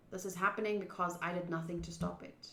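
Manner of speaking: ordinary talk, nothing marked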